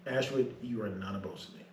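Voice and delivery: calm, cold dressing-down; captain reprimanding the sailor, quiet and stern; quiet stern rebuke. Retold or imitated.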